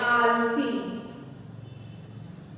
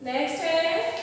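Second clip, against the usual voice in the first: normal talking voice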